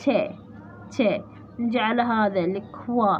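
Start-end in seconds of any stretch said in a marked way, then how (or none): 0.0-1.2 "تي" given alone, then repeated after a pause; syllable broken out. Shown as a demonstration. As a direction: sound out "تي" as a single syllable. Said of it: "هذا المقطع".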